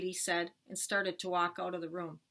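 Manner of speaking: usual speaking voice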